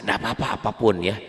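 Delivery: normal talking voice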